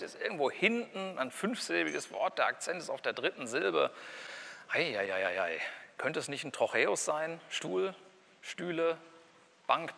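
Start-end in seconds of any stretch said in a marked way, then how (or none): 4.7-5.6 low, even syllables tapping out a stress pattern; rhythm demo. Shown as a demonstration. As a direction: count the syllables out low and steady. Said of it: "stress pattern demo".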